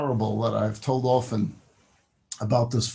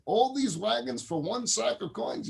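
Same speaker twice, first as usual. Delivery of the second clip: higher-pitched, excited disbelief; excited kid; 7 semitones higher than usual. Acted. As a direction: play the kid thrilled by the offer. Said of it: "Kid's all excited".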